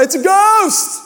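high-pitched mock scream of fright; terrified cry. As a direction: cry out like a panicked disciple, hammed up. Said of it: "They cried out".

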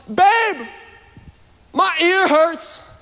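high, drawn-out whiny cry for help; retold scream. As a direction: call out high to your wife, hamming up the hurt. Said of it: "I scream".

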